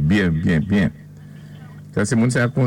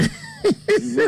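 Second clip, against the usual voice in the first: squealing laugh far above his voice; high burst of laughter. Real, not acted. Friend retelling the prison joke bursts out laughing high. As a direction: let out a high shriek of a laugh. Punchline lands.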